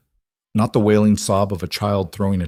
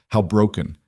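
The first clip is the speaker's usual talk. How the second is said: usual speaking voice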